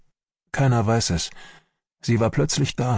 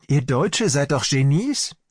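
higher-pitched, sneering imitation; grinning mockery; 6 semitones higher than usual. Angry doctor quotes the Russian doctor's taunt, grinning mockery. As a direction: mimic her taunt with a grin. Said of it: "grinst die mich an".

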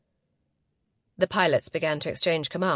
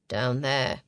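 man's voice; 6 semitones lower than usual. Acted. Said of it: "he replied".

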